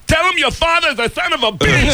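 high, far off mom demanding; imitating deaf mother; deaf mom's voice. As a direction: voice the mom hissing orders over his shoulder.

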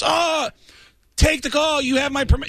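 high-pitched thrilled impersonation of the host; high excited quote. Retold or imitated. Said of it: "He goes".

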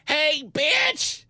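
high, loud shouted greeting; yelling. Retold or imitated. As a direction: yell it out high and sassy. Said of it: "the guy who goes".

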